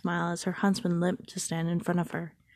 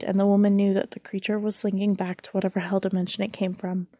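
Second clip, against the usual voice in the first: ordinary delivery, nothing marked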